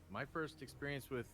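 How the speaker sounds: ordinary delivery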